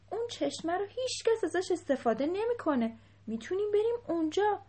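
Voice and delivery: high, urgent pleading objection; upset protest. A narrator's reading. Upset elephant interrupting the laughing king to object, high-pitched. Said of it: "فیل که حسابی ناراحت شده بود".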